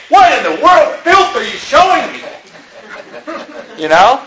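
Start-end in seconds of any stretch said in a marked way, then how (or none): 0.1-2.2 over-the-top indignant shout; harsh rebuke. Retold or imitated. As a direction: bark it like a disgusted scold. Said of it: "you don't say".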